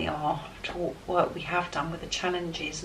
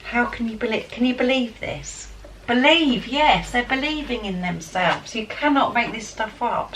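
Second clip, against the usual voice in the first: higher, louder, thrilled disbelief; can't believe the cards, gushing; excited; 5 semitones higher, 5 dB louder than usual. Real, not acted. Reader marvelling at the cards to viewers, thrilled. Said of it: "but I'm excited".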